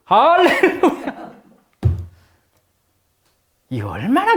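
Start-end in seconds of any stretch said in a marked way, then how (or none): 0.1-1.3 high, slow hallelujah with a laugh; a high, drawn hallelujah broken by laughter. Retold or imitated. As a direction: stretch it out, laughing with joy.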